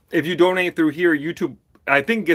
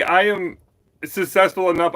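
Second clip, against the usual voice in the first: normal talking voice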